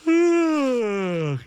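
big theatrical mock yawn; exaggerated yawn. Husband acting out the yawn in front of his wife, big mock yawn. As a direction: ham up a huge yawn. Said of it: "and just went".

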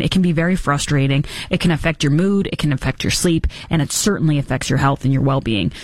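steady sponsor testimonial read. Acted. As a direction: read the dental ad like a satisfied patient.

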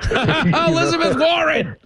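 high laughing shout of her name; laughing exclaim. Real, not acted.

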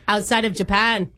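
hammed-up fake Aussie; broad mock-Australian prank caller; unconvincing Down Under accent; put-on Australian accent. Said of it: "don't sound Australian".

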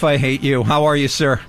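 ordinary talk, nothing marked

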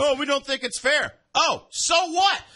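high, stretched mock complaint into a loud "so what"; mocking protest, dismissive retort. Host mocking those who think it's unfair, high and dismissive.

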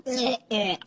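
soft, squeamish "eh, eh" of disgust; grossed out. Retold or imitated. Grossed-out recoil on hearing the date is a cousin. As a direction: recoil at the news he's a cousin. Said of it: "I was like".

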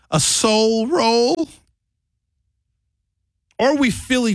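mock-disgusted question; incredulous. Real, not acted. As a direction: drawl it out in disbelief. Mocking the soul roll name.

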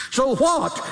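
very high-pitched, dismissive retort; preacher mimicking a brazen shrug-off; mock defiant sinner. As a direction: throw it back high and scornful. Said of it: "you say".

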